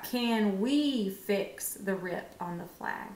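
slow, even reading; slow right answer. Shown as a demonstration. Teacher tries the right word for the class, slowly. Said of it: "What about".

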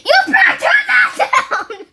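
high, loud laughing threat; mock yell. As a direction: shout it like a bossy parent. Kid mock-yelling at mom.

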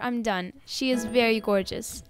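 usual speaking voice

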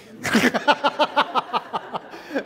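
high burst of real laughter. Real, not acted. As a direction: let a bright laugh break out. Laughs at own joke.

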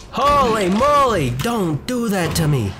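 high, loud, drawn-out protest; startled. Real, not acted. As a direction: wail it slowly after a jump scare. Reacting to a jump scare.

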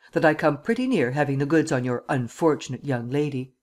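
ordinary delivery, nothing marked

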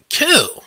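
"Kill." punched out high and loud as one hard word; a single stabbing "Kill."; emphasized kill. Retold or imitated. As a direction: hit "Kill." sharply, far above the rest. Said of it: "I'm emphasizing the word kill".

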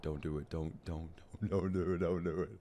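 barely audible, begging under the breath; hushed pleading. Real, not acted. Streamer begging Luffy not to come out like this, hushed. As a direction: plead softly as if afraid to jinx it.

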